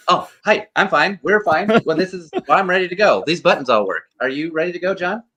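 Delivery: high, flustered, over-eager chatter; flustered babble. Retold or imitated. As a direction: gush nervously up high like a caught-out fan. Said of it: "and I go".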